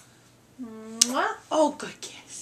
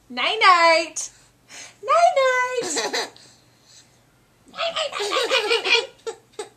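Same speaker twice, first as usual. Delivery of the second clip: high, bright sing-song goodnights breaking into laughter; playful excited night-night; 9 semitones higher, 3 dB louder than usual. Real, not acted. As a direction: chirp it up high while giggling. Parent plays night-night with child.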